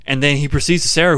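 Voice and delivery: ordinary delivery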